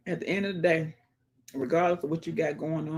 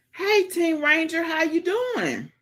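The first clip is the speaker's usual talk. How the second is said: higher, slow sing-song hello; cheery greeting; 12 semitones higher, slower than usual. Real, not acted. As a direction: stretch the greeting out brightly and slowly.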